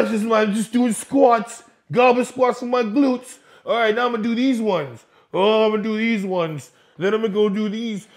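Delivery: loud, mocking gym girl voice. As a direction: parody her showing off her squats.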